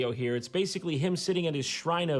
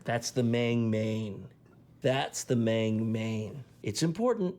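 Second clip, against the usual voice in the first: slow, low solemn guru; mock guru voice; 5 semitones lower, slower than usual. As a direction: intone it like a guru.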